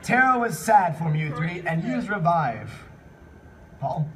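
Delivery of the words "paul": ordinary talk, nothing marked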